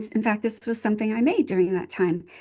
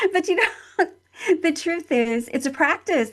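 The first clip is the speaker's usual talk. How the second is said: higher, laughter running through the words; laughing while talking; 8 semitones higher than usual. Real, not acted. Mother confessing to interviewer, still laughing. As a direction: keep laughing as you admit it.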